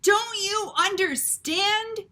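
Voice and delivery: high, loud, slow shout; shouting father. Acted. Lester shouting at his wife, high and slow. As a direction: yell it in frustration. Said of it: "shouted Lester".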